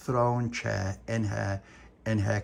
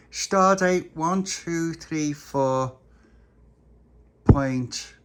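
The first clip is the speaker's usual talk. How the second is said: loud, higher, very slow count; captain's log announcement; 4 semitones higher, 6 dB louder, much slower than usual. Acted. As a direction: spell out the date grandly and slowly.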